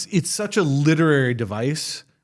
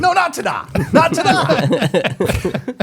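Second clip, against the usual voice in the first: high, drawn-out mock ta-da; 12 semitones higher, 5 dB louder, slower than usual. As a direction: sing out the ta-da mockingly. Mocking a character's flourish.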